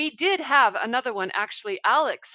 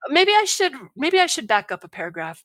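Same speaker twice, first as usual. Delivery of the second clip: usual speaking voice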